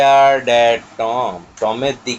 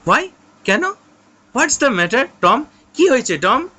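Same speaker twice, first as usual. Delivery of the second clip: alarmed; 7 semitones higher than usual. Acted. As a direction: panic and threaten to fetch aunty. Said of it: "সিড তখন এবার বলছে".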